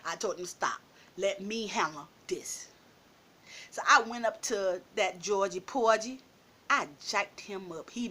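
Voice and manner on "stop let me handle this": low, hushed order, acted out; calm command. Telling her friends to back off, low quiet command. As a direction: take charge quietly, pitch dropped. Calls off friends to take over.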